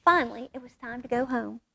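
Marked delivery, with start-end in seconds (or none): none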